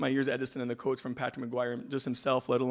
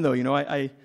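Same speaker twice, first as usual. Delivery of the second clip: ordinary delivery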